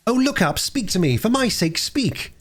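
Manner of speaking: put-on high-pitched children's plea in short bursts; earnest pleading. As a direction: beg him urgently in a child's high voice. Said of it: "as earnestly as they could".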